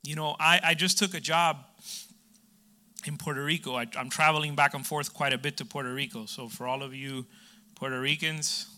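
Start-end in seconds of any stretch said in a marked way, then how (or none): none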